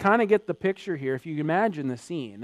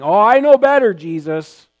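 loud, higher know-it-all brag; boastful Peter; 5 semitones higher, 14 dB louder than usual. Retold or imitated. Peter insisting to Jesus he knows better, boastful.

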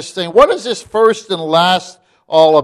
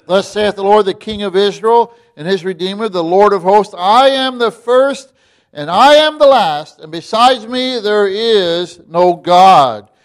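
proclaimed scripture; 3 semitones higher, 4 dB louder than usual. Acted. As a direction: thunder out God's words from the pulpit. Reads scripture aloud.

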